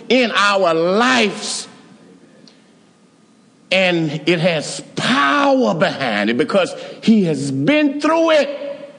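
preaching. Acted. Preaching at full force.

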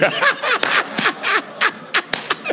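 high burst of laughter; a real laugh at his own story; high delighted laugh. Real, not acted. Preacher laughing at himself.

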